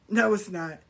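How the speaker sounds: ordinary talk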